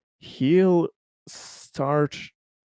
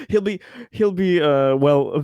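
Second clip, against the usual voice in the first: higher, louder, laughter breaking into the words; laughing through words; 9 semitones higher, 5 dB louder than usual. Real, not acted. Player resuming Conrad's plan after a whiskey joke, laughing. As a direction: try to carry on through a laughing fit.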